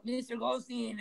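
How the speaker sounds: lightly voiced coked-up promoter; imitated wired promoter. Promoters addressing the band's manager, lightly imitated wired voice. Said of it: "they've been doing blow for days".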